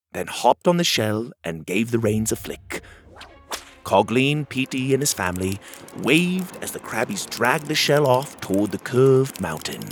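ordinary delivery

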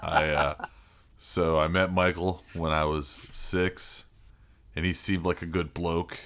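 laughing Aussie mate voice; Australian accent. Retold or imitated. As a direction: put on an Aussie bloke accent. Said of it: "kids' Australian accents".

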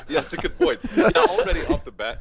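words squeezed out through laughter, high; laughing. Real, not acted. Reacting to a friend's joke, laughing high. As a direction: keep laughing as you talk.

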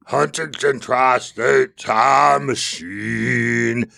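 slow, drawn-out Halloween host voice; a hint of a horror movie host; spooky announcer voice. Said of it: "Now I'm going into Dracula mode".

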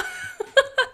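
high short burst of laughter; laughing. Real, not acted. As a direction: laugh at the story. Laughing at husband story.

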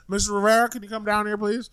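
lightly voiced police officer; mock cop. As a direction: call the old man down politely. Cops voiced in a story.

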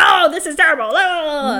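mock-dramatic dismay, lifted in pitch; hammed-up wail of horror; over-the-top reaction. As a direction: imitate a dad overreacting. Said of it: "over the top about anything".

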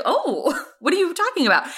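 high, mock-innocent denial; a prude pretending not to know, high; feigned innocence. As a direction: play dumb in a high voice.

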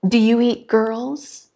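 slow, hesitant frightened ask; fearful question. Acted. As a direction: ask it as if afraid of the answer. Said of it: "she asked fearfully".

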